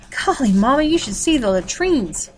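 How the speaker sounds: high, loud burst of excitement; excited shout. Acted. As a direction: call out, thrilled and breathless. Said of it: "shouted as she burst inside".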